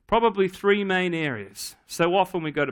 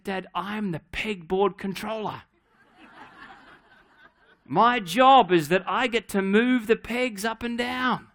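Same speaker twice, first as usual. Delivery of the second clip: put-on proud child; 4 semitones higher than usual. Daughter proud of new job.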